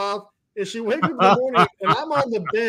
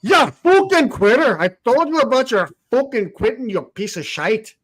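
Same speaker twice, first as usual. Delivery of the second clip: mock Scottish rant; 4 semitones higher than usual. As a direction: bark the insult in a put-on Scottish accent. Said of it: "the Spanish-Scottish side of me".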